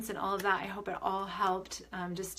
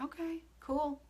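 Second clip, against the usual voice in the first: respectful acceptance; 8 semitones higher, 4 dB quieter, slower than usual. Retold or imitated. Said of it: "It's more just a respect".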